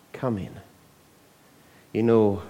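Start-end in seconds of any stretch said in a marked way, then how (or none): 0.1-0.6 soft plea. Acted. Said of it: "pleaded with him, begged him".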